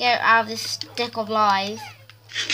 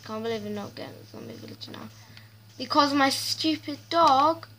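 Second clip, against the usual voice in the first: ordinary delivery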